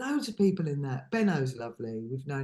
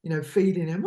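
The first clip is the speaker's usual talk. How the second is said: ordinary talk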